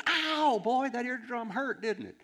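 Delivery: imitating an Ozark preacher, high, loud and slow; Ozark drawl impression. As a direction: crank up a folksy Ozark twang on the ow. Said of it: "so he kinda talked like this".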